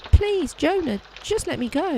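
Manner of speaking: high, begging, rising panic; desperate pleading. Acted. Maid begging the detective not to turn her in, high and desperate. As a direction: beg for your freedom.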